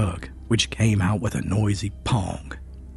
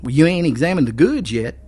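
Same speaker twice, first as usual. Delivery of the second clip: higher, louder objection; arguing; 8 semitones higher, 5 dB louder than usual. A narrator's reading. Storekeeper argues with the deputy, insistent. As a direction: push back at the officer. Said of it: "argued Phil".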